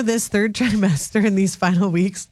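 usual speaking voice

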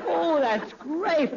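ordinary delivery

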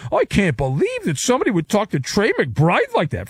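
put-on high voice of the scandalized; mock outrage. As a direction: mimic people up in arms, scornfully. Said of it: "dumb people are up in arms".

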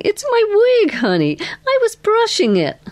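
very high-pitched, amused reply; amused, laughing. A narrator's reading. Girl explaining her wig to a visitor, amused. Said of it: "she said, laughing".

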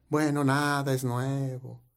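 slow, soft mock gloom; downcast complainer. Congregant asked what is new, mock gloom. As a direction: drag it out quietly, as if resigned. Same weary complainer answers.

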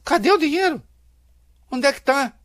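very high-pitched, pressing question; insistent demand. Real, not acted. Commentator demanding the missing money, insistent. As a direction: press the question hard, voice jumping up.

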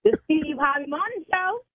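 high, controlled show-name reply; in her tone. Pretend. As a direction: lift the pitch and recite it obligingly. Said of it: "Tell me in your tone".

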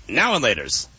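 "now and laters" with the "and" kept; full "now and laters". Shown as a demonstration. Host argues from the package, "and" included. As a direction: keep the middle "and" in "now and laters". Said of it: "it says and".

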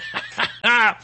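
short amused chuckle; little burst of laughter; laughing. Real, not acted. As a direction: chuckle at a silly joke. Laughs at the texted joke.

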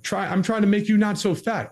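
mock angry wife. Wife firing back at husband in the sandwich fight, mock angry. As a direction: voice the wife snapping at her husband.

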